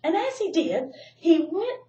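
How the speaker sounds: usual speaking voice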